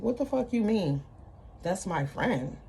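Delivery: usual speaking voice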